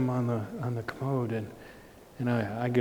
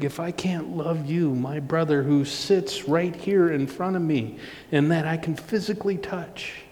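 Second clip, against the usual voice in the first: raised-pitch sermon appeal; earnest preaching; 4 semitones higher than usual. Real, not acted. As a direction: preach it higher, pleading the point home.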